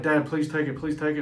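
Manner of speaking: put-on pleading boy. Boy begs dad to help.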